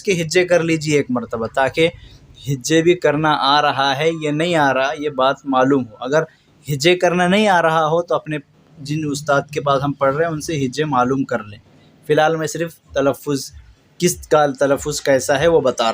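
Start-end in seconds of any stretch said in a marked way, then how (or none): none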